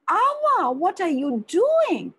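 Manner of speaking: high, loud startled question; shocked cry. Acted. Granddaughter shocked as grandmother touches her feet, loud and high. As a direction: gasp it out in disbelief.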